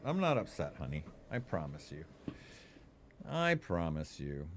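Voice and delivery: soft, gentle promise to the dog; soft reassurance. Real, not acted. Owner promising his dog he is not upset, soft and gentle. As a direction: quietly soothe her. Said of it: "I have to reassure her".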